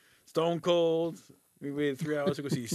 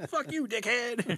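rude waiter voice; 9 semitones higher, slower than usual. Retold or imitated. Rude staff at Dick's.

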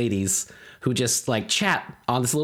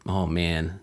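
normal talking voice

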